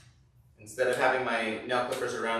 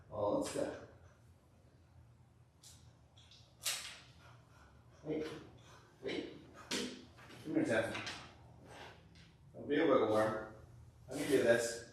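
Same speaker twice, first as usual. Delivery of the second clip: hushed pleading to dog; 11 dB quieter than usual. Real, not acted. Dog won't stand still.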